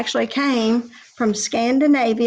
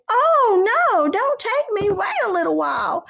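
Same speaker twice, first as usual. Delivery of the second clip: higher, begging middle goat; pleading goat; 4 semitones higher than usual. Acted. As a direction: beg him to wait, pitched up. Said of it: "second billy goat said".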